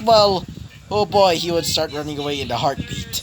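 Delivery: usual speaking voice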